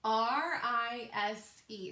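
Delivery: R I S E spelled letter by letter, slow; teacher spelling R I S E aloud; spelling slowly. Shown as a demonstration. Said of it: "So we spell rise".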